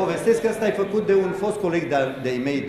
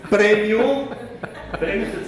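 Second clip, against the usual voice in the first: laughing; 6 semitones higher, slower than usual. Real, not acted. Awarding prize to audience member.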